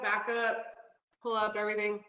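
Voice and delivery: ordinary talk, nothing marked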